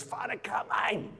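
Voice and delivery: high mock Rocky call to the priest. Rocky calls out to his parish priest, high mock shout. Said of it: "he goes".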